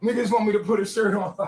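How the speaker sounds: usual speaking voice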